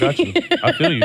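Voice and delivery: high, laughing mock-soothing voice; laughing mock comfort. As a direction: coo it through a laugh, teasing. Mock comforting crying toddlers.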